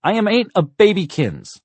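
put-on very high, loud little-boy outburst; infuriated child. A narrator's reading. Four-year-old answering his sister's taunt, infuriated yell. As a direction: yell back in outraged protest. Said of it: "always guaranteed to infuriate Blakey".

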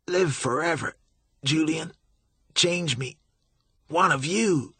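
high, strained, pain-racked plea; desperate begging. A narrator's reading. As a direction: beg with your last breath. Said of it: "Billy was begging".

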